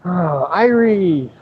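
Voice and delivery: slow, high, hammed-up Jamaican accent; drawn-out mock Jamaican greeting. Joking about Irish Jamaicans in a hammed-up Jamaican accent. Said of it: "sounding like a leprechaun".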